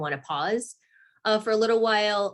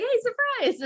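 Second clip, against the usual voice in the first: high, playful pretend announcement; mock surprise announcement; slower than usual. Mom jokingly springing news on her kids, mock surprise. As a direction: pipe it up high as a joke surprise.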